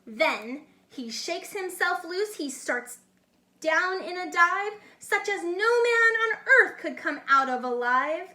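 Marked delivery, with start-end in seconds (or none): none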